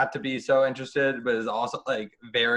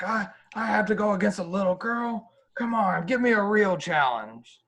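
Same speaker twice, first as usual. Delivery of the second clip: trash-talking wrestler; 9 semitones higher than usual. Retold or imitated.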